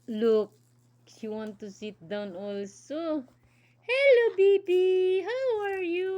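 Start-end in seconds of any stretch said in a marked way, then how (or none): 3.8-6.1 high, bright-eyed toy voice; lightly voiced cheerful doll greeting; playful doll voice. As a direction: speak for the doll to the baby. Mother voicing doll to toddler.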